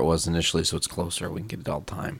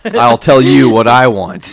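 mock demand; 3 semitones higher, 9 dB louder than usual. Continuing the mock customer.

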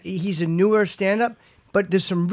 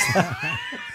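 burst of high laughter; 9 dB quieter than usual. Real, not acted. Laughing hard at the joke about the pizza place, shrieking laugh. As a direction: howl with laughter.

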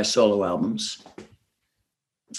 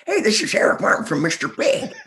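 high, put-on rock-guy voice; imitating friend's version of me; 9 semitones higher than usual. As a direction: do the cartoon version of yourself. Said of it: "he thinks I talk like this".

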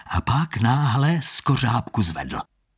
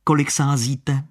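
higher, needling hustler voice; pushy hustler; 7 semitones higher, slower than usual. Acted. Con man needling Standa to stake five hundred thousand. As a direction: goad him into a bigger bet.

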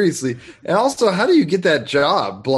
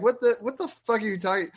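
baffled retort; 8 semitones higher, 3 dB quieter than usual. Acted. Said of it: "And you're like".

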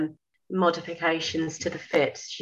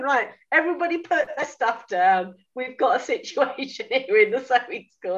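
higher, playful call to the room; mock announcement to class; 7 semitones higher than usual. Telling the whole sewing class to stop, higher mock announcement. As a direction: call the whole class to attention, tongue in cheek.

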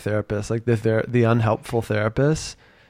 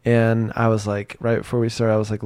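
normal talking voice

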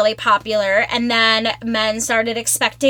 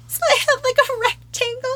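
laughing; 11 semitones higher than usual. Real, not acted. Laughing retelling bikini line mishap.

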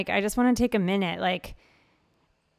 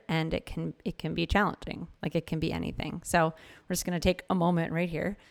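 soft hushed meditation guide; guided meditation; 3 semitones lower, 6 dB quieter than usual. Acted. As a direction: gently invite the listener to breathe and look inward. Continues guided check-in.

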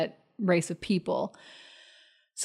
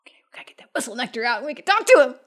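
rapid, urgent stage whisper; excited whisper; 4 semitones higher, 4 dB louder, much faster than usual. Pretend. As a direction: whisper it fast to your co-conspirators. Urgent aside to fellow players.

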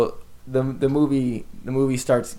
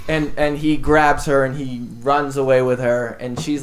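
ordinary talk

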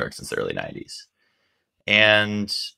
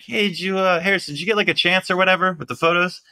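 higher, brisk, casual voice of a street character; Radio Man's voice; 11 semitones higher, faster than usual. Retold or imitated. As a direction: chirp the question like a cheeky regular. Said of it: "And he's like".

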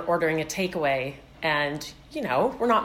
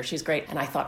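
normal talking voice

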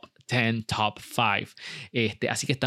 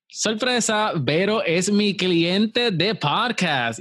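excited reveal; 7 semitones higher, much slower than usual. Real, not acted. Big reveal to the audience.

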